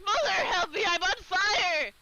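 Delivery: high, loud cry for help, slowed on fire; hammed-up panic. Dottie yelling to her mother after hot soup. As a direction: wail for mother with burning mouth.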